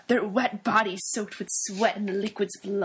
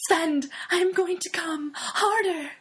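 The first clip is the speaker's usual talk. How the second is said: hammy scream; 7 semitones higher than usual. Acted. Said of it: "she screamed".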